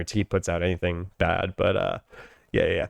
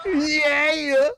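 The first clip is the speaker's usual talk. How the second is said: much higher, excited [name] shout; [name] yell; 3 dB louder than usual. Retold or imitated. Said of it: "Little, Little John from Dave Chappelle Show".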